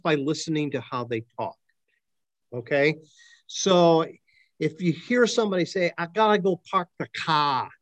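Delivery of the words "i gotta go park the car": put-on Boston-style accent with dropped r's; imitated New England accent, pitched a bit up. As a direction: say it like a New Englander, r-less "cah". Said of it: "okay, they're from New England somewhere".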